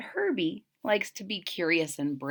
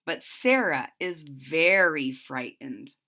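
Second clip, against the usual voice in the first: ordinary delivery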